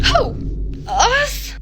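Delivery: high, loud mock surprise; incredulous surprise. Friends answer a call from afar, incredulous surprise. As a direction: squeak the questions in disbelief.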